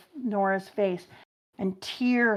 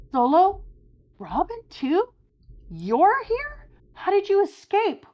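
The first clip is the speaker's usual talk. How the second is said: much higher, startled voice; surprised crewmate; 13 semitones higher, 4 dB louder than usual. Acted. Crewmate surprised to find friends, high startled. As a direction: sound shocked to see them.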